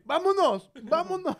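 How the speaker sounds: loud, high mock shout; imitating Eric shouting. Teasing Eric by imitating him, loud mock shout. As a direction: bark it like the pushy friend. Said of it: "Me imagino a Eric así".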